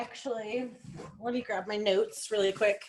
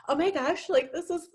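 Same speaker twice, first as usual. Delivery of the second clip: high, gushing mock excitement; excited customer; 8 semitones higher than usual. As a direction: imitate a thrilled customer, pitched up. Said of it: "got all excited and was like".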